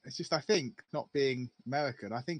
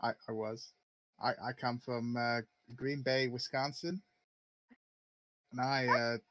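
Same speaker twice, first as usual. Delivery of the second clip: fake American accent. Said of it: "imitation accents I've heard you do for an American accent".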